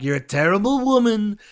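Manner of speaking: mocking Trump impression. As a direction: ham up Trump's insult. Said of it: "Donald Trump says something like".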